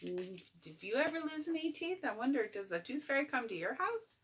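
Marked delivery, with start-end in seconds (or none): none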